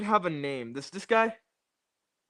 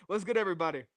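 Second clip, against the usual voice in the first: ordinary talk, nothing marked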